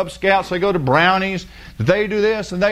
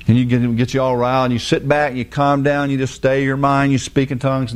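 ordinary talk

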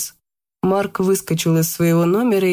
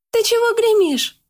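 put-on much higher, irritated girl's voice; annoyed. A narrator's reading. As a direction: snap at the noisy visitor. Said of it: "недовольно".

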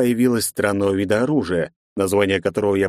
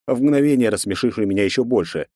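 usual speaking voice

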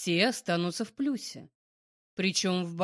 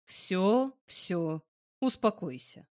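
soothing; 4 semitones lower, much slower than usual. A narrator's reading. Man calming angry woman.

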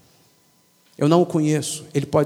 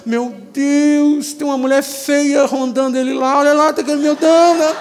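higher, mock-worried fussing; fretting wife in heaven; 8 semitones higher than usual. A wife in heaven fretting over her widowed husband, mock-anxious.

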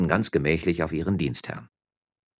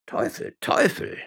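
muttering to himself, first word voiceless; muttered curse; slower than usual. A narrator's reading. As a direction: curse under your breath. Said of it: "murmelte Herr Juan Lopez".

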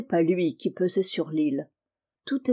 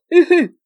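very loud, high hooting cackle; Jean-Marie's sniggering laugh; 9 semitones higher, 12 dB louder than usual. A narrator's reading. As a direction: burst into a wild, high "hu-hu". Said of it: "ricanait le roi de la mer".

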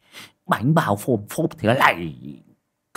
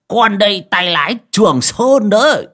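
higher, louder tipsy bragging with a laugh; drunken boast; 10 semitones higher, 8 dB louder than usual. A narrator's reading. Drunk driver reassuring his master, laughing boast. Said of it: "lè nhè".

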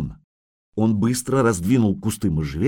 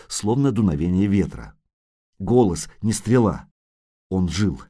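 plain reading voice

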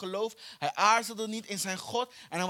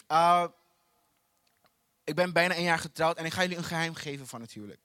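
ordinary talk

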